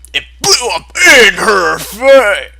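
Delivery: loud delighted outburst; gleeful shout. Real, not acted. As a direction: cheer the explosion like a fan. Gleeful reaction to the grenade.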